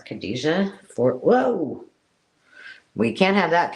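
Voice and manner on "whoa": sudden high startled cry; real surprise, a sharp high whoa. Real, not acted. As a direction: yelp as something slips. Fabric falls unexpectedly.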